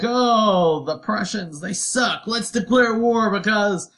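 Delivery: rile up the troops, half joking; mock Napoleon rant. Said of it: "That's what Napoleon the Third says".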